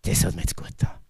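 unvoiced, whispered relief; breathy relieved sigh. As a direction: breathe it out like a tired man.

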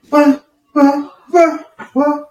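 high, loud muffled wah wahs; muffled wah wah talk. Retold or imitated. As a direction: mimic a trombone-like mumble. Mocking co-host as inaudible.

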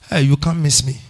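ordinary talk, nothing marked

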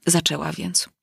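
plain reading voice

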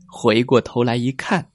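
ordinary delivery

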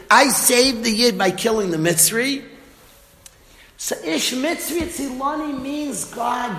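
ordinary talk

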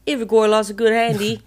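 put-on English accent. Repeating the contestants' line, English accent. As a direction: proudly show off an English accent. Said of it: "That sounds English as fuck".

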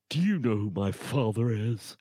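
entitled villain, sneering and smug; lightly voiced eighties movie snob; drunken posh sneer. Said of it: "the villains and the bad people in it would do that".

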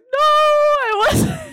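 high, drawn-out loud "no"; exaggerated wailing denial; mock protest. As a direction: deny it loudly, stretching it out. Acted denial in retold exchange.

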